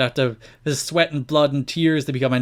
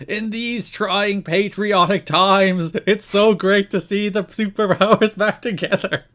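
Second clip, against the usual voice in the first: slow, higher patriotic broadcaster voice; pro-America mode; 7 semitones higher, slower than usual. Retold or imitated. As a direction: ham up solemn national pride. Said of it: "Don West goes into full, like, pro-America mode".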